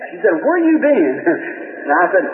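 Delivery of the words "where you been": high-pitched imitated wife; eager questioning. Wife greets her happy husband, high eager question. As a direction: ask it high and wondering.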